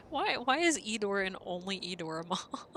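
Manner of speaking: baffled, giggly question; laughing question. Real, not acted. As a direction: break up laughing while asking. Laughing at friend turned moth.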